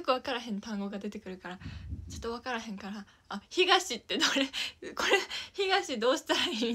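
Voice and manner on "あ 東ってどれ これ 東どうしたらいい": louder, laughing, confused asking for help; flustered asking. Retold or imitated. Asking her brother at mahjong, loud and laughing. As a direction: blurt it out lost at mahjong, half-laughing. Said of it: "みたいな言ってる".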